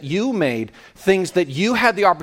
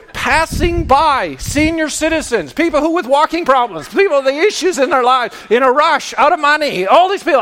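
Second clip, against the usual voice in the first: higher, louder gloating glee; gleeful; 7 semitones higher, 4 dB louder than usual. Retold or imitated. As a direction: crow over finding the short line. Gleeful retold dash to short till.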